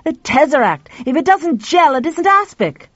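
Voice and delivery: high, loud, exasperated man; frustrated. A narrator's reading. Man upstairs corrects the timid woman, high and exasperated.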